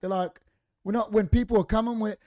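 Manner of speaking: put-on a bratty teenager sneering back at the neighbour; cheeky teen mockery. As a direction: ham up a mouthy teenage lout. Teens defiantly answering back.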